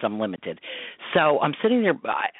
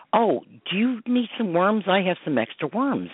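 put-on lightly raised, higher child's voice; little boy voice; 7 semitones higher than usual. Mother retelling the strange boy at the dock, lightly imitating his higher child's voice. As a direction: pitch up to voice an eager small boy.

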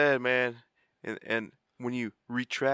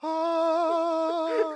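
high stunned gasp; mock shocked ah. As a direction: freeze in shock, pitch way up. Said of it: "they just sit there like".

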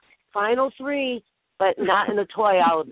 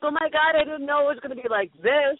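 pitched up, hammed-up shock; mock shocked Johnny Mac; 6 semitones higher than usual. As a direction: play him caught off guard, exaggerated. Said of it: "Johnny Mac will be like".

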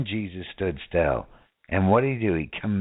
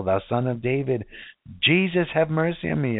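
high pleading cry for mercy; high pleading cry of a blind beggar; 7 semitones higher than usual. Acted. Voicing Bartimaeus crying out.

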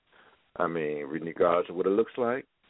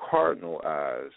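ordinary talk, nothing marked

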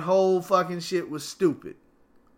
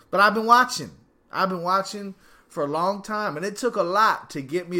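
insistent; 4 semitones higher, 7 dB louder than usual. Real, not acted. Insisting he's a real fan.

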